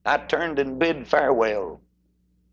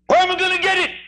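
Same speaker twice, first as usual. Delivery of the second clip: anxious sinner; 4 dB louder, faster than usual. Acted. Sinner's anxious question in sermon.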